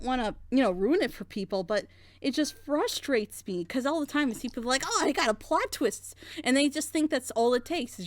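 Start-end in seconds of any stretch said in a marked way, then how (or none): none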